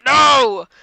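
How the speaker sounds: loud, high shout. Retold or imitated. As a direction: bark a flat refusal. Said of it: "Joe and Ellen are screaming at each other".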